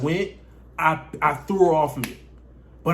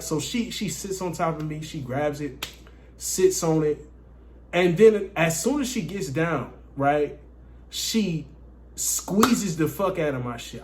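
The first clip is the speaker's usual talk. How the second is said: ordinary delivery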